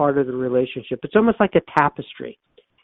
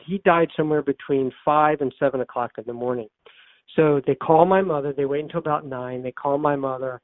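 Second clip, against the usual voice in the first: usual speaking voice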